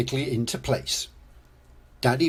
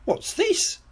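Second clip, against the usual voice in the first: put-on very high, curious child voice; Peppa Pig. A narrator's reading. Peppa finds something under a bush, high and curious. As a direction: ask it in a high squeak. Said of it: "says Peppa".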